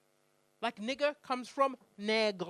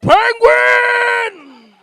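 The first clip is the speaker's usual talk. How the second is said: long, very loud, higher shout; full drunken shout; 12 semitones higher, 24 dB louder than usual. Retold or imitated. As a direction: bellow it across the street. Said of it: "he started to yell".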